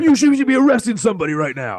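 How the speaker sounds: high, fast, loud indignant mock voice; mock indignant heckler; pushy bystander. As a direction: jab it at the cop like a busybody. Mocking a pushy bystander at cop.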